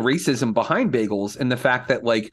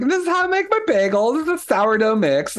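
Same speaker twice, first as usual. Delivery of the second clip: much higher, put-on prim voice of the TV baker; mocking bagel maker; 11 semitones higher than usual. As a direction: mimic her sweet self-assured tone, high up. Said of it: "she's just like".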